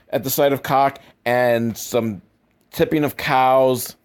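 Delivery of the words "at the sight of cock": ordinary talk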